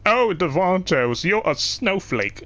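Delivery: sing-song the insult slowly; mocking strangers.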